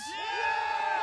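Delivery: high crowd cheer; hammed-up fan cheer; mock cheer. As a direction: yell it like a happy fan. Cheering as Sharks fans.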